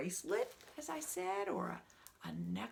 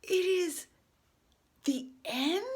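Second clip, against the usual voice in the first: loud, higher disbelieving question; dismayed surprise; 10 semitones higher, 11 dB louder, slower than usual. Acted. Gerald dismayed the book ends, loud high surprise. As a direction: cry out in disbelief.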